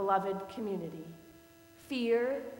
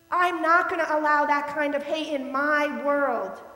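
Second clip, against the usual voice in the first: firm loud stand; 4 semitones higher, 8 dB louder than usual. Retold or imitated. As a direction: plant your feet and tell him off. Confronting the hateful man.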